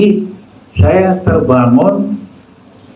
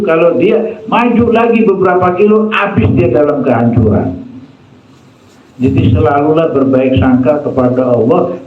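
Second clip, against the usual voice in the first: raised, insistent preacher's voice; fiery preaching; 6 semitones higher than usual. Acted. Preacher warning the congregation against idolatry, fiery and raised. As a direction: drive the warning home to the congregation.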